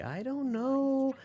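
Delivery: high, drawn-out doubtful quote; skeptical. Retold or imitated. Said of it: "I was really skeptical".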